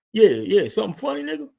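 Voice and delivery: high-pitched, loud taunting challenge; mock confrontation. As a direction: get in his face and dare him to laugh. Imitating Roman in Jimmy's face.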